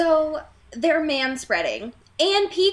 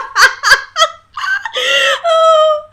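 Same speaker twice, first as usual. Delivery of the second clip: loud high shrieking laughter; 11 dB louder than usual. Real, not acted. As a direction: howl with laughter. Cracking up over Mike puking.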